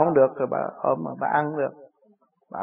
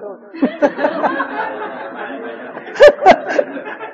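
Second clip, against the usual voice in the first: long high laugh. Real, not acted. Laughing at own joke.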